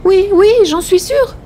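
high-pitched, strained assurance; high voice, over-eager to convince; anxious woman insisting. A narrator's reading. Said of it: "hésita quelques secondes, comme si elle eût fait un grand effort".

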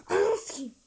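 high, loud angry curse; furious shout. Real, not acted. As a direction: yell the curse in rage. Cursing at missed game chance. (öfkeyle bağırış)

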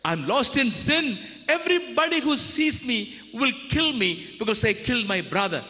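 a hint of a desperate Cain; Cain's fear. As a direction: plead in fear for your life. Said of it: "And Cain said".

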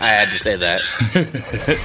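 usual speaking voice

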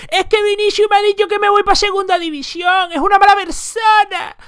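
high whiny mock voice, slowing at the end; mocking whiny victim. Mimicking rival players complaining to the press, high and whiny. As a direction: sneer at the crybaby defenders. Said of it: "ir de víctimas a la prensa a decir".

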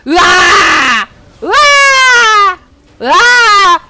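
loud drawn-out yell; slow, loud war cry; battle roar. Real, not acted. (한타 중 길게 크게 지르는 기합)

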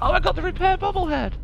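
high thrilled exclamation; excited. Real, not acted. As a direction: squeal with delight at the prize. Finds bobblehead.

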